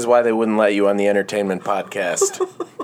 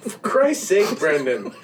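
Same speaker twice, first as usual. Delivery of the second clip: laughing; 7 semitones higher, slower than usual. Real, not acted. Laughing exasperation.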